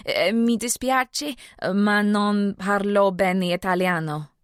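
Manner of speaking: put-on halting American accent in Italian, slow; terrible broken Italian. Audrey to an Italian caller, in slow broken Italian. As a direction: stumble through the Italian like a tourist. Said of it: "she said in her terrible broken accent".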